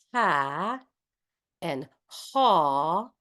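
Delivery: ha open, then haw with the tongue drawn back; ha and haw vowels. Shown as a demonstration. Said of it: "you can feel how".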